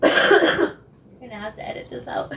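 slow, breathless croak after a cough; barely any voice left, forcing it out; losing voice. Real, not acted. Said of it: "I'm losing my voice".

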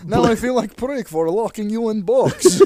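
comic Russian captor, amused; mock Russian accent. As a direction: apologise in a hammed-up Russian accent. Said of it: "now you have Russian accent".

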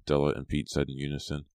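plain reading voice

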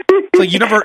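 normal talking voice

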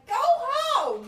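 high, loud jeer; heckling yell. Retold or imitated. Woman in the crowd jeering the team, loud yell. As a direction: shout it from the crowd. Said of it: "she's like".

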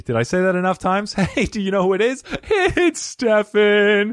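fast, high and loud, stretching out the name; giddy self-hype that swells to a long shout; excited. Acted. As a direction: hype up your own name like an announcer. Hyped self-introduction, rising excitement.

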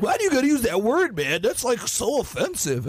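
high, prissy mock of an offended teen; whiny kid voice. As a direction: squeal it like a fussy kid. Mimics offended Gen Z kid.

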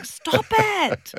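high panicked self-command; panicked inner voice. Retold or imitated. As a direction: snap at yourself in panic. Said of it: "and I'm going".

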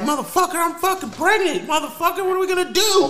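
angry wife. Acted. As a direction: shriek it out as a panicked, angry wife. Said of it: "my wife would be more like".

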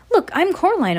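put-on insistent girl. A narrator's reading. Coraline introduces herself.

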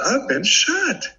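high, slow realization; dawning shock. Retold or imitated. Shot man telling himself he's hit, stunned realization. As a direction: let it sink in as you say it. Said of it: "I said".

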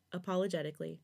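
usual speaking voice

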